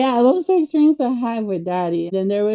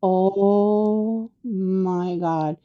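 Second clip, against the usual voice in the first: very slow, drawn-out retold dismay; stunned dismay; much slower than usual. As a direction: stretch every word as it sinks in. Reacting to being asked to direct.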